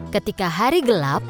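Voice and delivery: ordinary delivery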